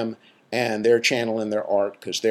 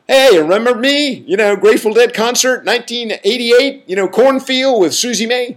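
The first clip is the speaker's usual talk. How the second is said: put-on much higher, louder grown kid voice, eager; long-lost kid; 11 semitones higher, 7 dB louder than usual. Imagined grown child greeting a surprised father, high and loud. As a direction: pop up like a surprise child saying hello. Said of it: "a kid running around somewhere going".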